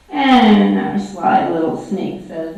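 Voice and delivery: ordinary delivery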